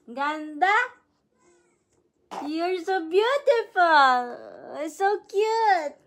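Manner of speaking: high, loud, gushing praise; stretched-out adoring squeals. Real, not acted. Mother admiring her dressed-up baby.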